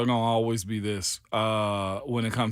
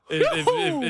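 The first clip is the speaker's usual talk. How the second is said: incredulous; 4 dB louder than usual. Real, not acted. Incredulous stammer.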